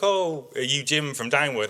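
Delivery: a hint of a kindly elderly farmer leaning from his car; old neighbour voice. Old farmer in his car greeting the boy, lightly voiced old man.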